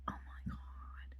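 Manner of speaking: slow, breath-only whisper; mock stunned whisper. Cellist hears of the grant, slow awed whisper. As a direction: whisper it in put-on awe.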